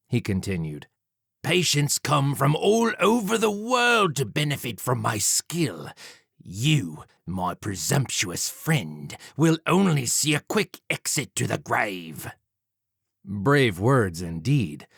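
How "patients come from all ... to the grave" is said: slow, vain villain; boastful Jack. Acted. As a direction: boast slowly of your skill. Villain Jack voiced in story.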